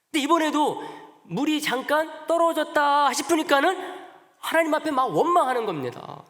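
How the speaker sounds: high, loud heated preaching; sermon rising to a passionate pitch; fiery preaching. Acted. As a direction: rail against the grumbling people. Preacher heats up on complaints.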